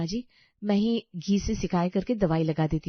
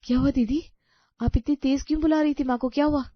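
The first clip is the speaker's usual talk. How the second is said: higher, louder worried questioning; alarmed concern; 5 semitones higher, 5 dB louder than usual. Acted. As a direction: rush in asking, alarmed. Alarmed at the crying sister-in-law. (घबराकर चिंता से पूछना)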